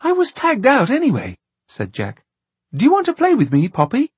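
put-on high, bright boy voice; child voice. A narrator's reading. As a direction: play an eager little boy. Narrator voices Jack child.

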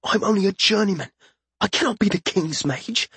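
urgent, high-pitched objection; panicked aside, pitched well up; hissed protest. A narrator's reading. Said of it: "Wesson hissed".

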